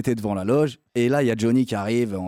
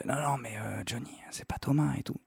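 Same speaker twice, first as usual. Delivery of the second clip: hushed whisper, softer; 4 semitones higher, 8 dB quieter than usual. Retold or imitated. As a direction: whisper it discreetly aside. Manager discreetly correcting Johnny.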